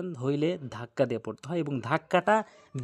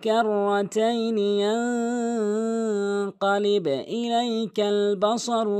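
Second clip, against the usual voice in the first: slow, higher, drawn-out tajweed chant; Quran recitation; 6 semitones higher, 4 dB louder, much slower than usual. Shown as a demonstration. Teacher recites the verse for learners, measured Quran recitation. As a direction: recite the verse with long held vowels.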